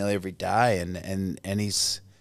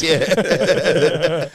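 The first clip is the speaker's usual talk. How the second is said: laughing; 6 semitones higher, 5 dB louder than usual. Real, not acted. Laughs at his own line.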